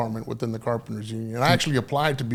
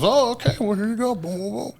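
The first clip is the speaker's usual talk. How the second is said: cheery hall clerk; 8 semitones higher than usual. Retold or imitated. Hall guy voiced, suddenly friendly.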